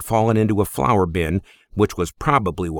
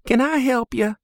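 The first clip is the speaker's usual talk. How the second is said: put-on much higher young woman's voice; Lizzie, girl's voice. Stable girl asks the stranger, much higher young woman's voice. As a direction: ask it straight, a bit wary.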